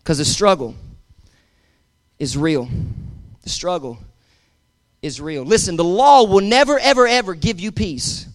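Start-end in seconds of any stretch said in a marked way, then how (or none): 0.0-5.4 low, soft, with long pauses; hushed emphasis. Real, not acted. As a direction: let it sink in quietly. Preacher drops after shouted exhortation.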